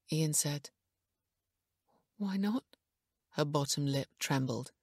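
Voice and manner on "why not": anxious. A narrator's reading. Lip trembling, fears bad news.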